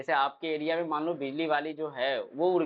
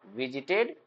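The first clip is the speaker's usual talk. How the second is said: normal talking voice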